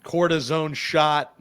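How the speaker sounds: ordinary talk, nothing marked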